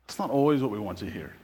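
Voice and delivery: a low, fast murmured restatement. Acted. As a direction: let it sink in, half to yourself. Preacher repeats line more softly.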